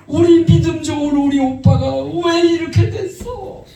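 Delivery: high, crying plea retold with a sob in it; tearful pleading. Sister sobbing and begging her brother to watch, high tearful plea. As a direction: beg your brother through imitated tears. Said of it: "막 울면서 막 애걸복걸을 하는 거야".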